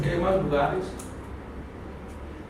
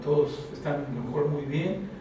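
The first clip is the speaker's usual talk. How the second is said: lower, much softer confiding preacher's voice; hushed, earnest pulpit talk; intimate sermon; 7 semitones lower, 10 dB quieter than usual. Acted. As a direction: lean in and confide it to the congregation. Intimate low preaching passage.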